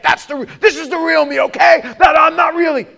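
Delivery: high, loud, insistent shouting; acted defensive outburst. You tell yourself this is the real you, defensive shouting. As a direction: shout over the doubt, insisting.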